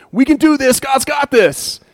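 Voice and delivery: high, loud rallying call; rallying cheer. Retold or imitated. Joshua rallying the people to trust God, a mock excited cheer. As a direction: pump up the tired crowd. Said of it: "stands up and goes".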